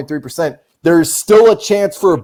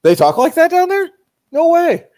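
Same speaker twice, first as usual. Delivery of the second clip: very high, squeaky mimicry; mocking falsetto; 13 semitones higher than usual. Teasing friend's take on Texan talk, mock falsetto. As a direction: throw it way up high in mock disbelief.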